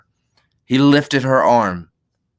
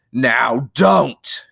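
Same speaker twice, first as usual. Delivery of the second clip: firm, louder warning; angry; 3 semitones higher, 5 dB louder than usual. Acted. Lenny growing angry with the struggling woman, angry. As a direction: order her to stop. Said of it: "Then Lenny grew angry".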